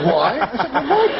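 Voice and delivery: very high, incredulous WHY; exasperated mock query. Comic retelling, asking him why, high mock exasperation. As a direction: send the question up into a squeak. Acted exasperated like-speak exchange.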